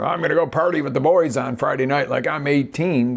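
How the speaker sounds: lightly put-on eighteen-year-old party boast; lightly mocked party guy. Coach imitates guys going out with the boys, lightly mocked bravado.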